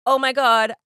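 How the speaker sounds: quick alarmed burst. Retold or imitated. Husband alarmed as she runs the stop sign, loud oh my God.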